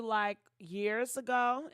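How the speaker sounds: normal talking voice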